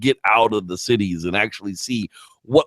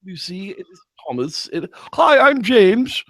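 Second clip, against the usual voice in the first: cheery ghost introductions; 8 semitones higher, much slower than usual. Retold or imitated. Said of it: "retelling movie scene".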